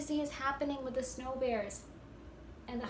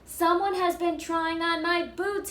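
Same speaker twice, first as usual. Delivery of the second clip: louder, booming Papa Bear; big booming bear voice; 5 semitones higher, 9 dB louder, slower than usual. Acted. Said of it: "he boomed in a big bear voice".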